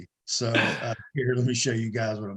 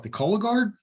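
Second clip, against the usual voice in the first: higher, incredulous rising question; surprised retold question; 10 semitones higher than usual. Recalling his own reaction to Andrea, high and incredulous. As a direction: jump up in pitch in disbelief. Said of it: "I'm like".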